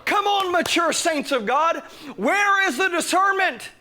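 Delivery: high, loud pulpit cry; full-force preaching. Real, not acted. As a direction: challenge the congregation at full voice.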